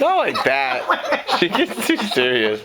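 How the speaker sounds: laughing. Real, not acted. Laughing correction.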